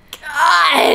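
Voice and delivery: a put-upon sigh of a vampire; mock Edward exasperation. Edward fed up with Emmett, mock exasperated. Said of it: "Edward's like".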